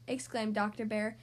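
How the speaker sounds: usual speaking voice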